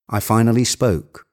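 ordinary delivery, nothing marked

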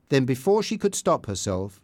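ordinary delivery

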